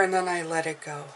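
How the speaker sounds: ordinary talk, nothing marked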